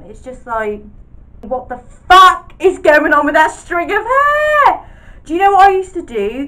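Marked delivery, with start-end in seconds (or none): 1.4-6.5 loud, higher, shocked outburst. Real, not acted. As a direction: exclaim in disbelief. Shocked at old photo.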